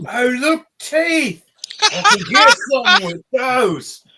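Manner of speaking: high, loud, gleeful creature voice; an orc boss thrilled to find his own teeth, high and loud; delighted dim orc. Acted. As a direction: squeal with dumb delight.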